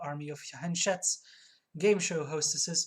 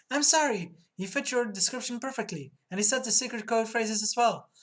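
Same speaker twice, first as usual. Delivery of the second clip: higher, pleading put-on voice; anxious apology; 5 semitones higher, 4 dB louder than usual. Clerk apologizing for giving goods away, anxious. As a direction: start high and loud, pleading. Frightened clerk makes her excuse.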